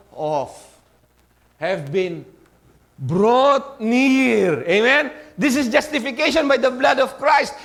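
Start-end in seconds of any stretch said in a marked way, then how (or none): 3.0-7.5 loud, higher full-force preaching; rising shout of justification by the blood; fervent preaching. Real, not acted. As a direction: lift the congregation with fervour.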